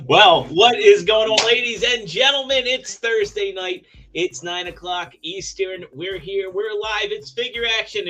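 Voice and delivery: loud, high, slow announcer kickoff; show host opener. Acted. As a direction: open the live show like a hype host. Host opens live show.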